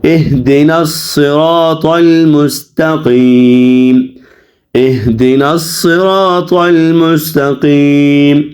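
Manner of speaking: slow, measured recitation with drawn-out vowels; Quran recitation. Shown as a demonstration. Teacher models the verse for students, slow recitation. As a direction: recite slowly and evenly, stretching long vowels.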